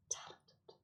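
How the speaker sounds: whispered punchline, almost silent. Acted. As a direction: breathe the word out as a joke. Self-deprecating joke about singing.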